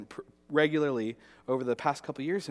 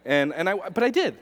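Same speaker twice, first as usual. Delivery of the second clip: ordinary talk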